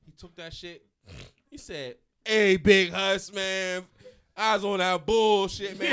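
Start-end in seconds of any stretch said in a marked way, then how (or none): 2.2-5.9 very loud, high, drawn-out yell; hammed-up, stretched-out holler; imitated hollering. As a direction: bellow it slowly, imitating a friend. Acts out friend's sudden comeback shout.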